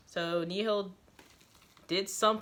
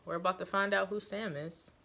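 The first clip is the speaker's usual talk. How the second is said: ordinary delivery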